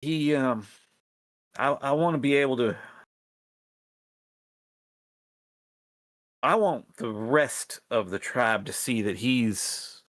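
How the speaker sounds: lightly voiced rat plotter in folksy dialect; folksy character voice, halting appeal; earnest rebel rat leader. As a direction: hesitantly plead for the tribe's support. Rebel leader answers the players.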